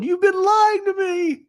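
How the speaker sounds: very high, slow wailing accusation; high slow mock wail of a child. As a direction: cry out betrayed like a little girl. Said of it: "she starts wailing".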